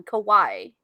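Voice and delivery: "Kawaii" said as kah-WAI, ending like "eye"; Japanese pronunciation. Shown as a demonstration. Co-host teaching Japanese pronunciation, "Kawaii" ending in a clear "eye". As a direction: demonstrate "Kawaii" with a falling "wai". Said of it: "quick lesson in, in, in Japanese pronunciation".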